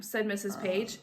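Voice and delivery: plain reading voice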